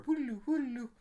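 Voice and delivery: squeaky high-pitched nonsense call; playful falsetto whoodaloo; silly high yodel. Pretend. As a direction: sing it out like a goofy siren. Silly noise ending aside.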